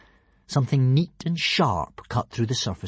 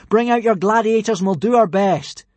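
high, loud challenge; calling out; 10 semitones higher, 8 dB louder than usual. A narrator's reading. Hiccup challenges Alvin from the boat, calling out. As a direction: project it across an arena. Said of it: "Hiccup called out".

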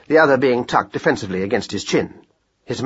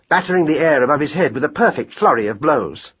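ordinary delivery

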